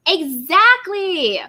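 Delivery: slow, loud and stretched; emphatic exactly. Real, not acted. Host agreeing with a viewer, slow emphatic exactly. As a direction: lean hard on it.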